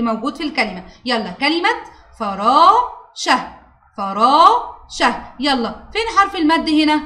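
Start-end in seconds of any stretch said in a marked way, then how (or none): 2.2-5.2 "فراشة" very slowly, the "raa" held long; long vowel shown. Shown as a demonstration. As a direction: stretch the alef in "فراشة". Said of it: "نقرأها ونشوف المد". (مدّ الألف في "فراشة")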